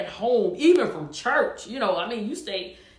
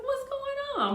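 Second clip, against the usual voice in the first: high-pitched, light voice for a baffled self-quote; mock bewilderment, pitched way up; puzzled self-quote; 14 semitones higher, 4 dB quieter than usual. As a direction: retell your own bafflement in a high, soft voice. Retells puzzlement at failing breath.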